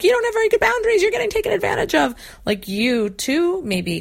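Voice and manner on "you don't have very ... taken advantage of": high, loud and fast, much higher pitched; mock a frantic friend nagging about boundaries; high, loud, fast hammed-up nagging; frantic lecturing. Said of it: "if you're running to them yourself and being like".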